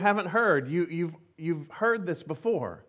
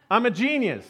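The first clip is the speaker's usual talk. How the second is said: usual speaking voice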